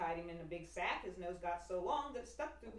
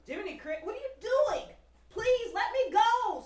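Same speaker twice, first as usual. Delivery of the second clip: high, loud desperate plea; frantic pleading; 14 dB louder than usual. Acted. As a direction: beg to be let out, panicked. Pinocchio caged, pleading.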